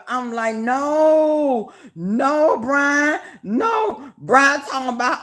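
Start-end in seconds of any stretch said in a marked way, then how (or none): 0.6-4.1 high, drawn-out pleading no's; mock hollered disbelief. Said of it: "I'm hollering".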